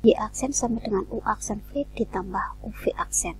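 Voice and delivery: ordinary talk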